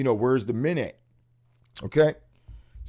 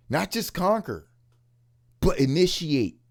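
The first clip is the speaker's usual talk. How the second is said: ordinary talk, nothing marked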